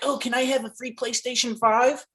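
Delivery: high-pitched mock begging voice; pleading commenters. Imitating people asking Santa for gifts, pleading commenters. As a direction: beg like an eager online commenter. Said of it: "So people be like".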